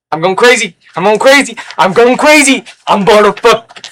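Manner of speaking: high, loud, frantic repeated outburst; mock cracking-up rant; hammed-up meltdown. Hammed-up outburst of going crazy.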